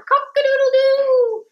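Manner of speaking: playful rooster call; rooster crow. Shown as a demonstration. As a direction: crow again for the class to copy. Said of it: "That's a fun sound".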